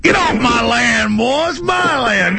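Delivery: hammed-up Texan shout, slow and high; Texas voice. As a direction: run the boy off your land in a mock Texas drawl. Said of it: "do your Texas voice".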